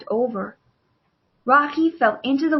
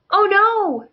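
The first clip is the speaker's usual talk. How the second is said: high, louder sudden dismay; alarmed; 9 semitones higher, 5 dB louder than usual. Acted. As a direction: gasp it out in alarm. Remote sinking, Rocky alarmed.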